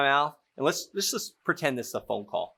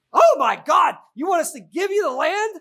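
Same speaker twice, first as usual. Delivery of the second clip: much higher, much louder mock outrage; shocked seller; 9 dB louder than usual. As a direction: act the seller's loud shock at the lowball offer. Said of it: "And Lisa goes".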